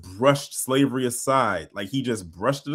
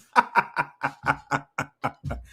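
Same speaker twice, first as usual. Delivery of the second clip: real laugh. Real, not acted. Laughing at the host.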